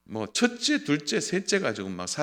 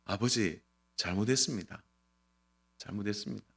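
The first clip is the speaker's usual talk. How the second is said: put-on soft, low, subdued child's apology; humble apology; 5 semitones lower, 9 dB quieter than usual. Child owning up to father, humble and soft. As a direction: murmur a meek confession, voice dropping away. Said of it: "제가 바라는 건 딱 하나예요".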